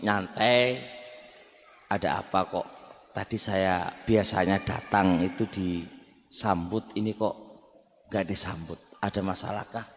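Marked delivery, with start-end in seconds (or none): 1.9-9.9 soft, unhurried question to the spouse; calm, gentle asking. Shown as a demonstration. Husband gently asking his wife why he wasn't welcomed, calm and soft. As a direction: ask it with a cool head. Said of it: "sampaikan dengan kepala dingin".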